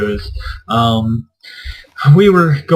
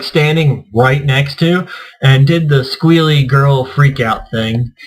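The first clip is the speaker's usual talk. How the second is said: ordinary delivery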